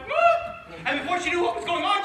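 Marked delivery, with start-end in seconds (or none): 0.1-0.8 high squeaky whoop. Acted. Princess's mouth starting to smile, high squeak. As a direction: pop the sound up high like a mouth twitching into a smile. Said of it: "the other side of her mouth went".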